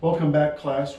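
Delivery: loud, upbeat class greeting; loud welcome. Real, not acted. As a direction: project it to the whole class. Host opens a class segment.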